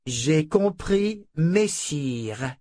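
j'ai compris, messire drawn out slowly, stress on compris; correcting, slow and pointed. Shown as a demonstration. Fitch correcting Claudine's missing title, slow and pointed. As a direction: spell out the proper reply slowly. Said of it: "On dit".

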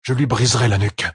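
a touch of anger held in; growled threat. A narrator's reading. Perrine vows revenge for his wife, mildly growling. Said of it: "grogna-t-il".

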